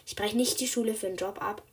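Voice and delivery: usual speaking voice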